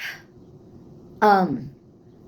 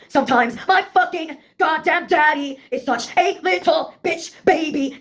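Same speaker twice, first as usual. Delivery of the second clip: ordinary delivery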